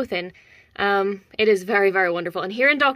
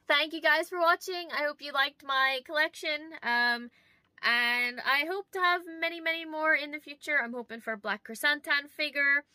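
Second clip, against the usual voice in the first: soft sign-off; 6 semitones higher, 7 dB quieter than usual. Acted.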